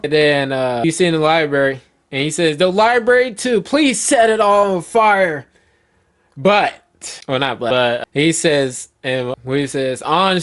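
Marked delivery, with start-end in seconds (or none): 2.6-5.5 high-pitched dramatic plea; pleading cry. Acted. Elder Gerald begs them to burn the library too, pleading cry. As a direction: keep pleading in a high, strained-up voice. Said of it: "Elder Gerald screams".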